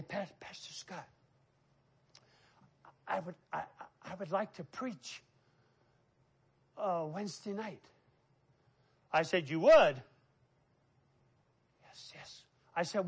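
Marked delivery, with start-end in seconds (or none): 0.0-7.8 soft, halting, hesitant request; timid stammering whisper. Retold or imitated. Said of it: "That's how he spoke". 9.5-10.0 surprised. Retold or imitated. Pastor surprised by request. 11.8-12.4 breathy timid whisper; imitated whisper. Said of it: "That's how he spoke".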